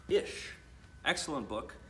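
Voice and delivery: usual speaking voice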